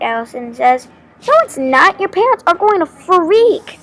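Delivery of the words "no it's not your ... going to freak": high, loud, swooping protest; panicked yell. A narrator's reading. Girl warning her friend about the parents, yelling. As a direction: yell it in alarm, hitting the last word. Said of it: "I yell".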